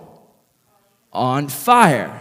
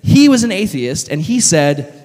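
usual speaking voice

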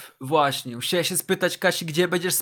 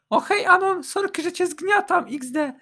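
high falsetto female character; Kasia's voice; 12 semitones higher than usual. A narrator's reading. Kasia waking on top of friend, high falsetto apology. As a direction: apologise in a high put-on woman's voice.

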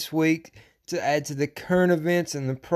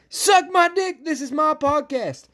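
high, shouted, angry defiance; defiant yell; 11 semitones higher than usual. Real, not acted. Podcaster defending his right to rant, defiant shouting. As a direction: yell it far above your talking pitch. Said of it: "Sorry about yelling".

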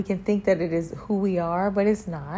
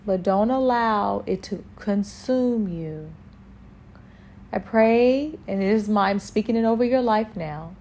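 normal talking voice

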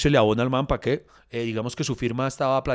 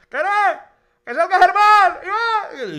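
very high, loud call to someone far off; imitated crowd shouting; 6 dB louder, much slower than usual. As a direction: yell like a fan calling from the queue. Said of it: "y empiezan a gritar". (gritos agudos imitando a la gente)

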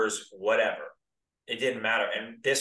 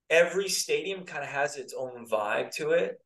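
normal talking voice

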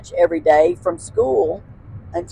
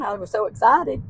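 ordinary talk, nothing marked

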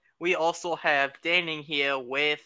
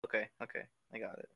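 ordinary talk, nothing marked

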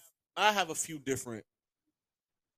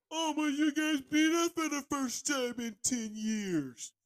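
slow, higher mocking whine; mocking fans' taunt; 12 semitones higher, 3 dB quieter, slower than usual. Mocking the fans' old boast in a higher, slow whine. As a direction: mimic their smug boast. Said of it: "What happened to".

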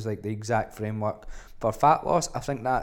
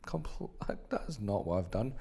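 laughing whisper; 7 dB quieter than usual. Real, not acted. Whispered joking aside.

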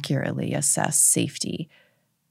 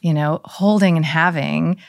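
ordinary talk, nothing marked

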